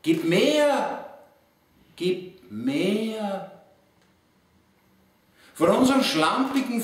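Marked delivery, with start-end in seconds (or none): 0.0-1.2 high, drawn-out poetic cry; recited call with a raised voice. Acted. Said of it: "und wir rufen laut". 2.0-3.5 higher, drawn-out call held back in volume; recited call, lingering on MEHR; restrained echoing call. Acted. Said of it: "und wir rufen laut".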